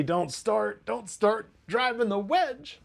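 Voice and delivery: high, drawn-out hammed-up objection; mock protest. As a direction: voice climbs into a comic plea to stop. Mock pleading retort to Bunny.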